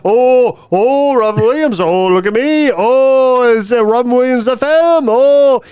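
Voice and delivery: lightly voiced manic comic, mocking; mock Robin Williams. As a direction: ham up a zany comic to mock him. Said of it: "You put on a fake voice".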